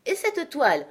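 high start dropping sharply; a sudden exasperated query, lightly acted; alarmed exclamation. A narrator's reading. Uncle asking grandmother about the cloth, mildly alarmed exclamation. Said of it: "s'écria mon oncle".

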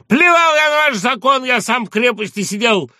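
high, loud defiant yell; angry shout. Acted. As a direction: throw the threat back at the gendarme. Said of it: "кричал жандарм".

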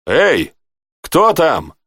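a light hail to whoever is there. A narrator's reading. Rey hails the unseen guard at the gate, calling out. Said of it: "окликнул Рэй".

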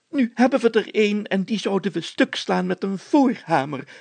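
usual speaking voice

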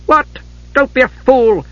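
high-pitched, sharp rebuke; outraged disbelief. A narrator's reading. Mother stunned by her son's refusal, outraged.

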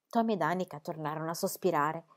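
ordinary delivery, nothing marked

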